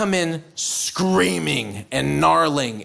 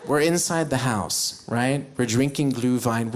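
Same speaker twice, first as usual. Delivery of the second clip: ordinary delivery, nothing marked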